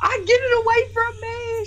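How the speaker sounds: high, overwhelmed mock wail; mimicked freak-out. Host mimicking fan begging brother to take bunny, freaking out. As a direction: imitate someone overcome by cuteness. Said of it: "starts freaking out".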